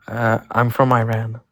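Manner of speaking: Iran said the American way, eye-RAN; American accent. Real, not acted. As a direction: caller says Iran the American way, slowly. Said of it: "completely with American accent".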